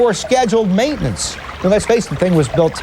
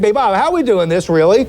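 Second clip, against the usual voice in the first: ordinary talk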